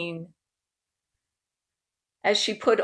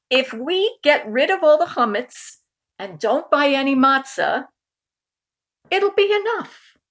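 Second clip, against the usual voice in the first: higher, rising with a high peak on be; cheerful proposal; 6 semitones higher, 4 dB louder than usual. Acted. Uncle Murray proposing a plan, higher and upbeat. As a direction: pitch it up brightly to the end.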